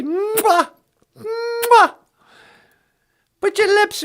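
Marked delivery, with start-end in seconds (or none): 0.0-1.9 kiss sound. Acted. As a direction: blow a big kiss to the viewer. Blowing kisses at camera.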